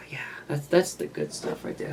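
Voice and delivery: hushed savoring whisper; breathy secret aside. Real, not acted. Savoring glue smell, whispered aside.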